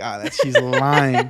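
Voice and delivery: laughing through a slow, loud mock accusation; laughing disbelief. Husband teasing wife about her story, laughing loudly. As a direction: tease her that she's lying, laughing hard. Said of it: "I was like".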